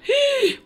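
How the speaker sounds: high sharp gasp of shock. Acted. (yüksek perdeden şaşkın nefes)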